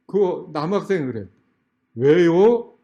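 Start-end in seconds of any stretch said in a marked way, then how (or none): 1.9-2.8 loud, cheeky comeback; mock student's blunt challenge; defiant retort. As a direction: throw it back like an unimpressed teenager.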